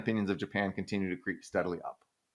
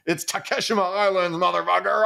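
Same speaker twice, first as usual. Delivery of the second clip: much higher, louder hammed-up shout; mock nationalist yell; 9 semitones higher, 4 dB louder than usual. Old Japanese nationalists yelling at Korea, mock yell. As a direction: bellow it from a boat like a cranky old nationalist. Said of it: "and be like".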